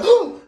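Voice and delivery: high, loud mock gasp of fright; sudden high "oh"; startled yelp. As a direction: imitate someone jumping out of their skin. Imitating her startled reaction.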